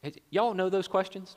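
ordinary talk

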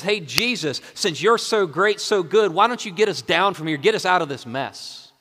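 loud, high-pitched sarcastic taunt; mocking demand from a bitter man. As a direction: throw it at him as a scornful challenge. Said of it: "He sarcastically says".

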